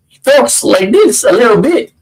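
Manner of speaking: half-hearted stab at Walken's cadence; Christopher Walken impression. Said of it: "you guys tell me if that was sounded like him".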